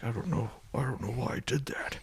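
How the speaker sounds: breathless whispered confession. Acted. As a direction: whisper it in shame. Superman's confused aftermath.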